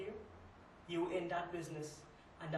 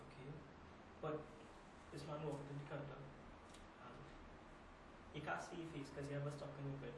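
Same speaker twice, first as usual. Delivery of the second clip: hushed recounting; 2 semitones lower, 7 dB quieter than usual. Acted. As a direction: recount the hold-up softly, with halting pauses. Recounting a frightening hold-up in monologue.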